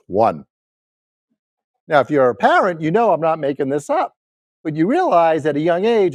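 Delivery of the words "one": much lower, curt answer; low one-word punchline; imitated deadpan kid. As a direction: drop it low as a kid's grudging verdict. Son's deflating answer about sister.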